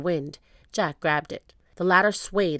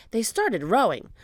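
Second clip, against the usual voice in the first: ordinary delivery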